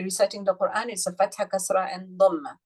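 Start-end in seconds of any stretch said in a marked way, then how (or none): none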